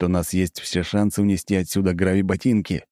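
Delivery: ordinary delivery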